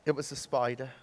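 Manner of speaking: put-on soft, sheepish admission in an Australian accent; small, softened confession. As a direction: own up quietly, embarrassed. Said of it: "The man sheepishly steps back".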